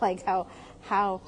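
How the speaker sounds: ordinary talk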